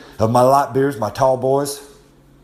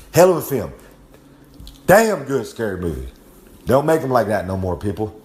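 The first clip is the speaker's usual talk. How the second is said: usual speaking voice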